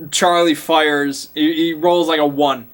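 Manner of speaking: normal talking voice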